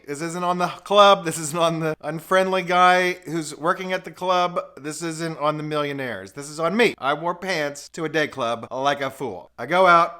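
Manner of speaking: usual speaking voice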